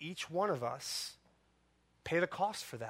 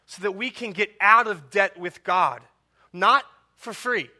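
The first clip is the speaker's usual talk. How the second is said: louder, rising to not for free; preaching emphasis; 3 semitones higher, 12 dB louder than usual. Acted. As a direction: pulpit insistence, louder.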